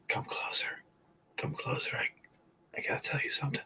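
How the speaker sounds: breathy dying whisper, beckoning close; deathbed whisper. Pretend. Dying man whispering a secret to his family. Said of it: "I'll be like".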